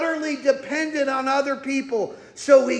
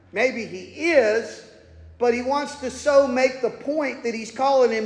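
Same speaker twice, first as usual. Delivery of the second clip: ordinary talk, nothing marked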